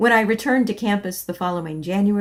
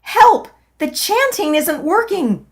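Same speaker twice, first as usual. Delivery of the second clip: much higher, much louder cry for help; desperate yell; 10 semitones higher, 8 dB louder than usual. Acted. In hip pain, yelling to C E for help, a high loud panicked yell. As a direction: scream it out in panic. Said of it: "I yelled".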